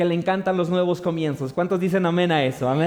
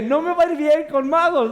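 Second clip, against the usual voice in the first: nervous worry; 5 semitones higher than usual. Retold or imitated. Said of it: "Yo estaba asustado".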